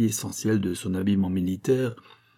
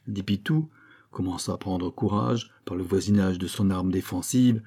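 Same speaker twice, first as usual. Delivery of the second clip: usual speaking voice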